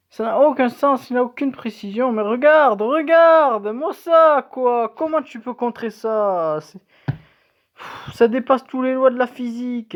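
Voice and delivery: high, loud indignant outburst; outraged disbelief. Real, not acted. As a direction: rant in shocked disbelief at the play. Outraged at opponent's impossible play.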